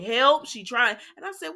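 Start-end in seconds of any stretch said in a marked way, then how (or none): 0.0-0.5 mock scream for help. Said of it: "Kelly's screaming".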